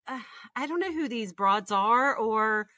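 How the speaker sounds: usual speaking voice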